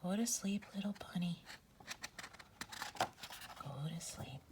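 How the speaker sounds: soft whispered lullaby. Acted. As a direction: whisper the bunny to sleep. Lulling the bunny to sleep.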